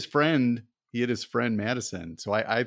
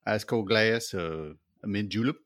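put-on Southern accent. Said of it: "[name] is dialed in".

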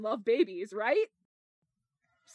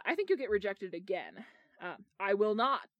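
usual speaking voice

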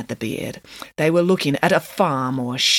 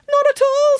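high, loud burst of reassurance; bright denial; 6 dB louder than usual. A narrator's reading. Nanny dismissing Samantha's worry that she is hurt, bright denial. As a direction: sing out the denial high and strong.

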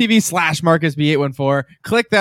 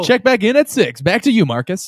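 higher-pitched mock TV anchor sign-off; announcer voice; 5 semitones higher than usual. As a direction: hand back to the studio like a newsreader. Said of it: "your announcer voice there".

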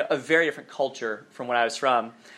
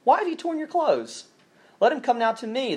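higher, lightly voiced prophet's challenge; prophet's rebuke; 8 semitones higher than usual. A narrator's reading. Elijah rebuking the king of Israel, a hint of raised voice. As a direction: call out to the king from a distance.

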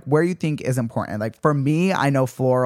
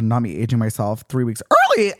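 normal talking voice

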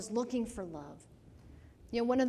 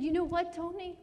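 higher, louder, emotional address; tearful retelling; 8 semitones higher, 5 dB louder than usual. Retold or imitated. Speaker retelling how they wept with Tony, moved and earnest. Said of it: "I started crying with him".

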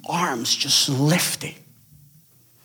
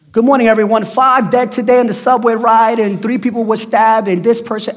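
loud, high, chipper newscaster voice; news anchor; 9 semitones higher, 10 dB louder than usual. Retold or imitated. Preacher mocking the morning news for his congregation, loud and high. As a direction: blast it like a cheery morning anchor.

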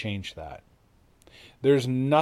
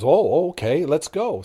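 eager excitement; 4 semitones higher, 4 dB louder than usual. Acted. Pardoned prisoner eager to meet king.

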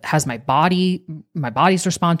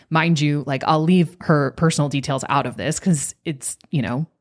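ordinary talk, nothing marked

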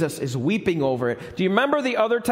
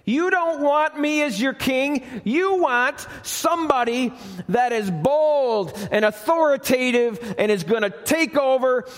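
impassioned crowd voice; 7 semitones higher than usual. Retold or imitated. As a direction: demand a conquering king. Voicing the crowd's demand.

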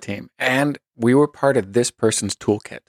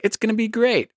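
mock optimism; 10 semitones higher than usual. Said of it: "And I was like".

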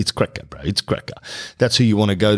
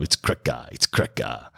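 mock mumble; 9 semitones lower, 5 dB quieter than usual. Mocking the slang word again.

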